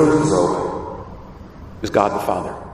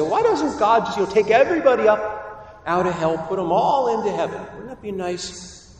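higher, pleading mock question; naive questioner; 6 semitones higher than usual. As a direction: act out a naive, wheedling asker. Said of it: "they'll say".

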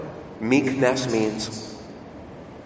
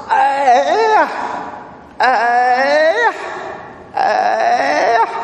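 put-on rambunctious boy's yell; 11 semitones higher, much slower than usual. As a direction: mimic a small child yelling while swatting. Boy swats the priest once more.

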